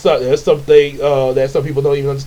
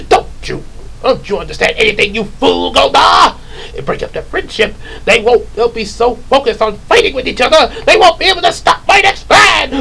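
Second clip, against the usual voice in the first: high, ranting villain; Rita and Lord Zed; 7 semitones higher than usual. Acted. Villain explaining the plan to Goldar, exasperated rant. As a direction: scold the foolish henchman, building to a shriek. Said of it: "like the way Rita and Lord Zed".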